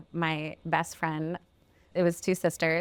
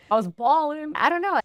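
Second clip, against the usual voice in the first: much higher, louder gleeful boast; excited; 10 semitones higher, 6 dB louder than usual. Real, not acted. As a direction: crow it out delighted. Laughing about cash gifts.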